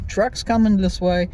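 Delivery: usual speaking voice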